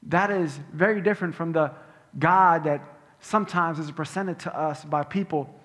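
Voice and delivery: ordinary talk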